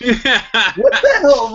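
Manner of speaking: high, slow, laughing what the hell; laughing disbelief. Real, not acted. Laughing disbelief at liberals' claim.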